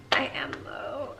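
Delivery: whispered secret confession; mock whisper aside. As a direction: breathe it as a joke secret. Joking aside to self.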